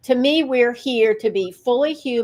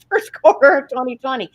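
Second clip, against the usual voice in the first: said through a real laugh; amused, words breaking into laughter; 3 semitones higher, 4 dB louder, faster than usual. Real, not acted. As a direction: laugh while saying it, a bit louder. Laughs at who felt peaceful.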